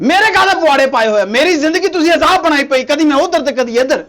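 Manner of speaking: exasperated husband yelling; angry shout. Acted. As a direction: shout your complaint at her. Husband quarrelling with his wife.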